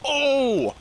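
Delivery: stunned at the twist; shocked gasp. Real, not acted. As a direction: gasp then a high loud Oh. Shocked discovery in game.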